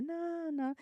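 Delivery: put-on high, soft childish protest; clinging crying child. Teacher imitating a child clinging to the school door, high whiny no. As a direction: whimper it like a toddler at the door. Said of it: "se agarran de la puerta y así de".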